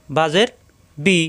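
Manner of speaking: ordinary delivery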